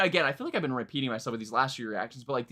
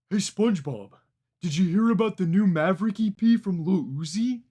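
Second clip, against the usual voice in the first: louder cartoon starfish voice, dopey; clumsy put-on Patrick Star, under pressure; goofy Patrick voice asking SpongeBob; Patrick impression; 5 semitones higher, 5 dB louder than usual. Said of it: "I should do Patrick".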